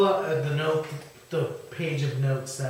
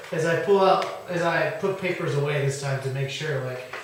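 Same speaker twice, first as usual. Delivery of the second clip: normal talking voice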